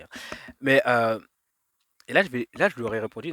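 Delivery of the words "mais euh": ordinary delivery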